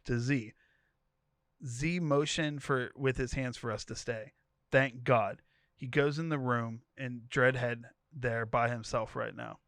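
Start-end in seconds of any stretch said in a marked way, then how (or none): none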